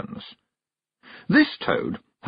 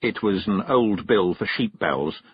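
usual speaking voice

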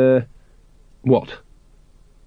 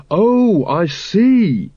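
naive dawning; 7 semitones higher, much slower than usual. Acted. Carrot hearing the song.

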